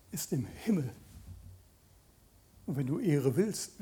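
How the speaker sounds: ordinary talk, nothing marked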